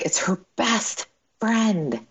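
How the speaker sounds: whispered, slow and tender; hushed aside. Real, not acted. Mother whispers about her daughter's best friend leaving. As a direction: drop to a whisper over the sad detail.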